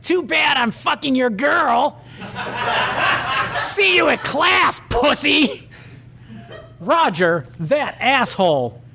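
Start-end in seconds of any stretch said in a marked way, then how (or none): 0.0-2.0 high, jeering bully voice, laughing and shouting; sneering bad boy cackling at the loser; mocking bully. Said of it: "It was Roger". 2.2-3.6 high jeering cackle; bully's mocking laugh. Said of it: "It was Roger". 3.6-5.7 high, jeering bully voice, laughing and shouting; sneering bad boy cackling at the loser; mocking bully. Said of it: "It was Roger".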